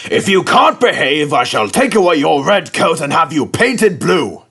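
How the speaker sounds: a strict boss warning an engine; stern telling-off; severe Fat Controller. A narrator's reading. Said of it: "spoke severely to James".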